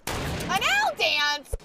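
loud, high, drawn-out question; excited exclamation. Real, not acted. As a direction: stretch it out slowly, high and loud. Excitedly echoing teammate's call for dance.

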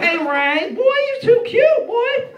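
very high, loud, drawn-out gushing; imitated secretary. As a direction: squeal a compliment as the secretary. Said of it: "She's like".

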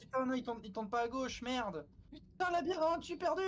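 high, frantic lost in the maze; panicking gamer. Real, not acted. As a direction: panic while searching for a way out.

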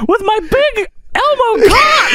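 very high puppet squeal; Elmo. Acted. Continuing Elmo's boastful apology.

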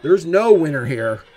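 loud, high ringside announcer call; announcer hype. Acted. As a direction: sell the names like a ring announcer. Hyped announcer calling the result.

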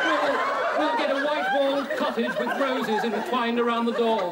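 loud, swooning stage heroine; melodramatic heroine. Acted. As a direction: swoon it out, hammed up. Acting the love scene.

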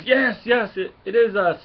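high, loud and delighted; gleeful shout. Acted. Drax answering the guards, loud and gleeful. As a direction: cheer it like you love the chaos.

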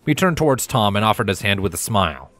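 plain reading voice

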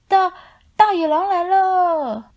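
high, loud stammering panic; terrified scream. A narrator's reading. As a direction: scream in terror, tripping on the word. Ghosts terrified.

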